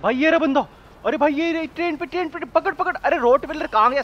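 high, loud excited yelling; excited shout. Real, not acted. Gamer yelling at the fleeing man on a train. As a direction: shout out as you spot him. Spotting the target, chasing it.

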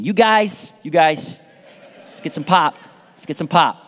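mock Midwestern accent; Michigan accent. Preacher switching back from Southern to Michigan, mock Michigan accent. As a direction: put on a Michigan accent, short repeated phrases. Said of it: "Okay, we're, Michigan".

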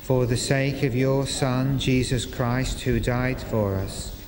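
ordinary delivery

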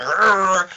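angry growl. Retold or imitated. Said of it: "now I'm like".

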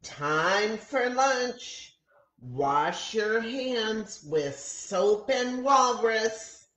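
a teacher sweetly calling lunchtime; lightly voiced sing-song teacher; teacher announcing. A narrator's reading. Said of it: "our teacher announces".

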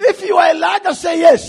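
loud call for a response; rally the crowd to shout yes; calling out to congregation. Real, not acted. Preacher calls congregation to answer.

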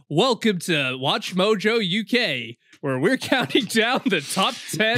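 slow, drawn-out YouTube countdown intro; video countdown host. Acted. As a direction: announce the countdown slowly, barely holding the laugh. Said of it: "Top ten most chilling Jimmy Savile T V appearances".

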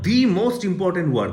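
"the" stressed as a slow, drawn-out "dee"; slow down and press on "the" as "dee"; emphatic the. Shown as a demonstration. Teacher stresses the most important work with "dee". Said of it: "আমি এখানে জোর দিয়েছি বা emphasis করেছি".

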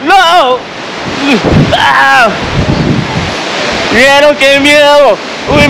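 high panicked yelling; screaming in fear. Real, not acted. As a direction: shriek with fear after the fall. Screaming after the jump.

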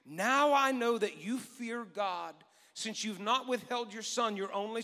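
slowed and deliberate on now. Acted. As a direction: savour each word. Reading angel's words slowly.

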